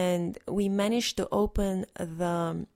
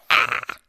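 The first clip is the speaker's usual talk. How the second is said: loud "Ah!" pushed from the throat; strained throaty shout; throat yell; 7 dB louder than usual. Shown as a demonstration. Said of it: "instead of like".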